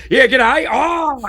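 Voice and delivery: high, loud mock-Aussie burst; broad Australian accent. Australian comic playing up his accent for locals, broad Aussie. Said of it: "I do an Australian accent".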